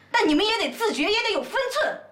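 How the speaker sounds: high, emphatic scolding; stern lecturing. Acted. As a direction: drive each demand home, pitched high. 婆婆训话. (拔高声调严厉训诫)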